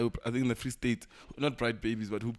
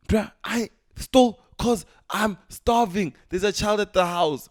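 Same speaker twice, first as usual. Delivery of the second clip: loud, high, slow pleading cry; mock desperate plea; 8 semitones higher, 8 dB louder, slower than usual. Starving thief begging the court for mercy, loud crying plea. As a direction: beg loudly like a starving thief. Prisoner pleading his case, acted.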